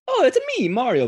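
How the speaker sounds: high, squeaky Mario cry; Mario impression. Podcaster mocks an over-the-top Mario voice, squeaky and high. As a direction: mimic Mario's cartoon Italian voice.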